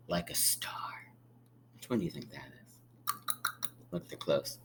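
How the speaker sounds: hushed storytime whisper; whispered to the children. Acted. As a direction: drop to a secret whisper. Hushed aside while reading to kids.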